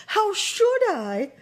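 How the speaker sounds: put-on young girl voice. A narrator's reading. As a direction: ask it puzzled and innocent. Dorothy answers the witch.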